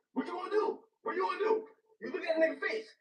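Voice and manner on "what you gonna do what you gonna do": put-on higher, nervous, stammering kid; mock the scared teen cornered by the villain; panicked Peter Parker. Retelling the teen hero facing the villain, mock panicked. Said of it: "He's like".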